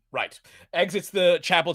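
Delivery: ordinary delivery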